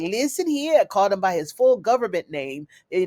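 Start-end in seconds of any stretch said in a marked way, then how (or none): 0.0-0.8 high-pitched mock Jamaican scolding; bad Jamaican accent. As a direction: shut him down in a hammed-up Jamaican accent.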